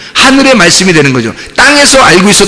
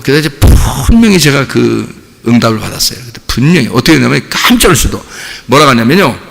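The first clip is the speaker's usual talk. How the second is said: normal talking voice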